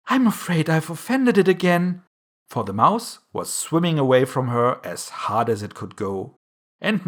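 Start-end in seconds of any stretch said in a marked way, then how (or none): 0.1-2.0 higher, louder dismayed tone; sorrowful. A narrator's reading. Alice fears she offended the mouse, sorrowful. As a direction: lament it, pitched up. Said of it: "cried Alice in a sorrowful tone".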